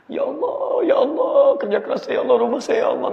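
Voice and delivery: high, wailing cries of ya Allah; mock wailing. Preacher voicing a man crying over his burnt house, high wail. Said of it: "nangis luar biasa".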